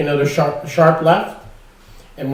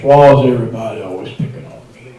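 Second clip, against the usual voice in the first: lower, deep country singer voice; 7 semitones lower, faster than usual. Retold or imitated. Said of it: "It's like [name]".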